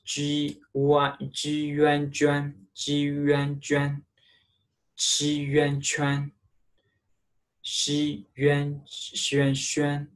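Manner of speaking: juan, xuan, chuan spelled out high; pinyin drill. Shown as a demonstration. Teacher drills juan xuan chuan for students. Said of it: "So it pronounced yuan".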